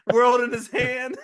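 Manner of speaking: high-pitched, cracking up while talking; laughing. Real, not acted. Tickled podcaster imagining young Charles on campus, laughing. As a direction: squeal it out through real laughter. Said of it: "I'm just laughing".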